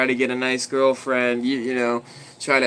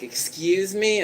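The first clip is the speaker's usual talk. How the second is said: indignant; 7 semitones higher, slower than usual. Retold or imitated. Neighbor's indignant retort imitated.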